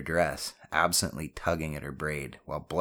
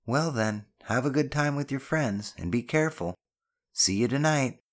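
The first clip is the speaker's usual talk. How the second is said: higher-pitched motherly send-off; mother parting with a gentle caution; loving mother; 8 semitones higher than usual. Acted. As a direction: bid him goodbye, pitched up. Voices the mother.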